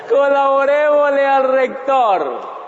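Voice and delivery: hammed-up eager professor; mocking repeat. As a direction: repeat her line through laughter, exaggerated. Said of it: "la profesora le dijo".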